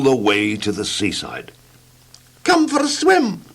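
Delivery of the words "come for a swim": very high little voice calling out; tiny eager call. Acted. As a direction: call him to swim in a tiny voice. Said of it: "cried Mister Small".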